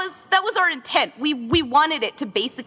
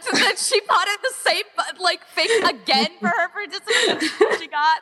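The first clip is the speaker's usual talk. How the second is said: giggly gossip; 7 semitones higher than usual. Retold or imitated. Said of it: "and we're like".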